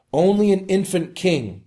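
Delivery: ordinary delivery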